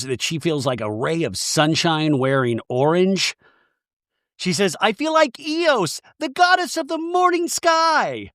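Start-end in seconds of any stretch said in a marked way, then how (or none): 4.8-8.3 dreamy goddess voice. Retold or imitated. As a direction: float it up high like a dreamy goddess. Recapping Brittany's dreamy goddess quote.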